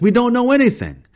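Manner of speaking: very high, loud outburst; furious guide shouting him down; angry cry. Acted. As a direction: yell it in exasperation. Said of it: "cried Travis".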